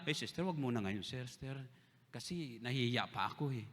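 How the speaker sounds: soft, low, bashful excuse; shy refusal. Retold or imitated. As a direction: back away sheepishly. Shy sister declines song leading.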